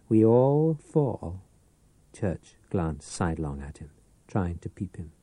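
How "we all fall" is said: drawn-out, strange voice on We all fall; slow eerie repeat. Acted. The pawnbroker taunts his old enemy, slow and eerie. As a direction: stretch every word, haunting.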